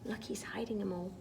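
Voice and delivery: quick hushed whisper. Real, not acted. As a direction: share it in a whisper. Whispering aside to child.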